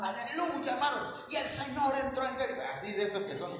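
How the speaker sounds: a hint of a hollering revival preacher; mock shouting preacher. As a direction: ham up an over-excited preacher. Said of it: "andaba predicando y gritando".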